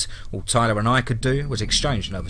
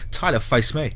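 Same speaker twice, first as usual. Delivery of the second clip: ordinary delivery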